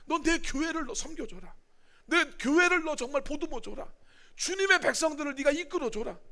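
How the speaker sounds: lightly voiced Jesus commissioning Peter; earnest charge. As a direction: urge Peter to care for the church.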